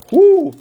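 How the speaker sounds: sharp, loud, high "whoo"; high whoop. Real, not acted. Breaker reacts to a player's injury news, high whoop. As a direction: let out a short high whoop.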